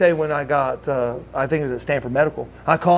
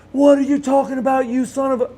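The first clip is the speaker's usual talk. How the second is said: high, indignant retold tirade; cussing someone out; 9 semitones higher than usual. Friend cursing the caller who phoned with news, angry. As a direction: lay into the caller in a raised voice. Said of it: "I'm getting dog cussed".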